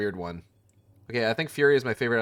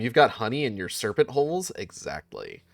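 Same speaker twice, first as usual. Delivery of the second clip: ordinary talk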